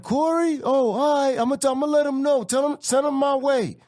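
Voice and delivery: high-pitched retold answer over music; calling back. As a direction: call back to the door over the noise. Acts Spike answering over loud music.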